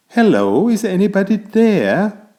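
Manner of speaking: high, loud call into the jungle. A narrator's reading. Narrator calling out to anyone on the island. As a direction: shout hello into the trees.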